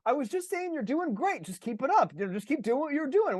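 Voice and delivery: high-pitched, backpedaling pep voice; hammed-up, defensive encouragement; placating rider. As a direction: squeak out hurried reassurance to annoyed teammates. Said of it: "And he's like".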